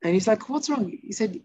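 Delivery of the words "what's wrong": high-pitched puzzled question; baffled borrower. Acted. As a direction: act out a bewildered man being yelled at. Borrower asks the screaming lender.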